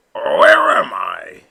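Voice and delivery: put-on slow, loud, dazed old duck; groggy papa waking up confused; an old duck blurting confusion, slowed; quacking. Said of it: "he quacked".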